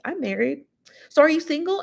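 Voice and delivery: high, sing-song gloating imitation; mock smug taunt. Imitating [name] flaunting her marriage at [name], high and smug. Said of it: "rub her marriage in his face".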